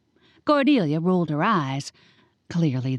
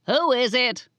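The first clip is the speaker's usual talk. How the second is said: shouting; 7 semitones higher than usual. Acted. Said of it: "Betty shouted".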